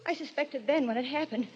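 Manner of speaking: ordinary delivery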